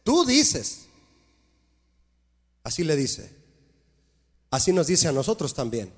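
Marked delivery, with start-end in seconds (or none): 0.0-0.8 the Lord rebuking. Acted. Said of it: "El Señor le dice a la Odisea".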